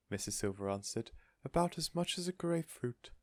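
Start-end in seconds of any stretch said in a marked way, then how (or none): 1.5-3.1 doting lady. A narrator's reading. Missus Silver telling the weight, sorrowful. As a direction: mourn the tiny number.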